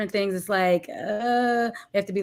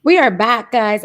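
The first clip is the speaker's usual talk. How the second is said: big loud hello; loud greeting; 9 dB louder, slower than usual. Real, not acted. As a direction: announce the return loudly. Returns after connection drop.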